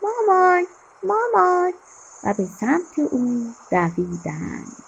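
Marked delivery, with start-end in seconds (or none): 0.0-1.7 put-on high, louder children's cry for mum; shouting. A narrator's reading. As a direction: call out loudly for mum, pitched up. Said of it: "با صدای بلند داد زدند".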